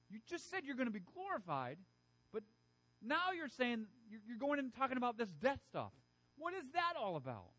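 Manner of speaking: high, baffled questioning; confused disbelief. Retold or imitated. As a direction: play a bewildered follower. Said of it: "Could you imagine being like".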